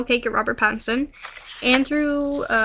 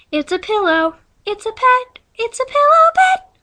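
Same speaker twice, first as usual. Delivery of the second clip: rising higher and louder to a squeal; building yell; 10 semitones higher, 5 dB louder, slower than usual. Acted. Izzy announcing the pillow pet, yell building to a squeal. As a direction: build each line up to a shriek. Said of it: "Izzy walked up and yelled".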